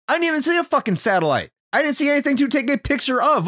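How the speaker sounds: high-pitched mock outrage; indignant protest. Player arguing with the game over the satellite, indignant protest. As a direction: protest in a high, incredulous whine.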